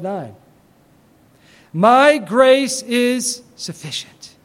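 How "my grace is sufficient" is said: slow solemn reading. Shown as a demonstration.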